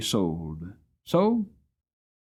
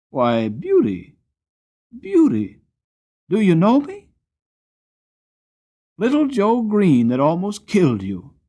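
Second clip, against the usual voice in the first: louder, much higher joyful cry of recognition; overjoyed; 10 semitones higher, 8 dB louder than usual. A narrator's reading. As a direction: burst out with delight at an old friend. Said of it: "as if he was quite overjoyed".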